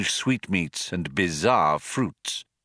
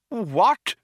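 high, rising cry of disbelief; aghast cry; 13 semitones higher than usual. A narrator's reading. Old man hears Earth is cancelled, aghast. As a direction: cry out, appalled.

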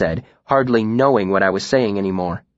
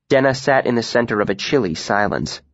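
plain reading voice

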